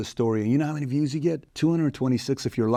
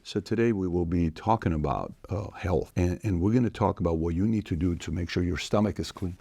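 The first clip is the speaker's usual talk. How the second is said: low, soft, plodding delivery; measured, unhurried pace; 7 semitones lower, 4 dB quieter than usual. Shown as a demonstration. Podcaster demonstrating how some hosts talk, measured and low. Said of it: "that guy talks at that pace".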